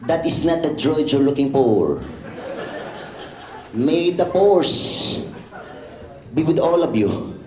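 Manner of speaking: put-on light Filipino accent as a Jedi. A Filipino-accented Jedi line, lightly done. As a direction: drawl May the Force in a Filipino accent. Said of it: "I'm gonna play it like this".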